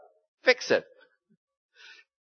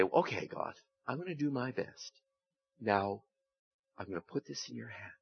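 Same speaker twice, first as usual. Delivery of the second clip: quiet, trusting prayer, fading softer; 4 semitones lower, 12 dB quieter than usual. Acted. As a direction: pray it gently, letting go. Voices a prayer.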